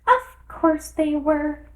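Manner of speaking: high, small dormouse voice; a tiny drowsy creature telling a tale; sleepy little dormouse. A narrator's reading. Dormouse voice held.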